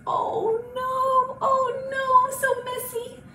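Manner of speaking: high, lamenting little cat voice; dismayed mama cat. Acted. Mama kitty fretting over the paint mess, high dismay. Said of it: "Mama kitty goes".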